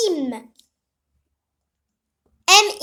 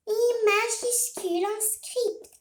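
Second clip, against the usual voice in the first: put-on softer, child pupil echoing I majuscule en script; Peppa repeating; 3 semitones higher, 7 dB quieter than usual. Pupil Peppa repeating after her teacher, softer. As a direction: echo it back meekly like the pupil. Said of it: "Répète après moi, Peppa".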